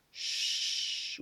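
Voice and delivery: "ch" as a long voiceless hush; shushing sound. Shown as a demonstration. Teacher imitating a shush for pupils, long SH. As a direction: hold "ch" like asking for silence. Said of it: "quand on fait chut".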